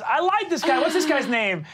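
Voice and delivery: delighted by the dog; cheerful David. Acted. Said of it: "he says".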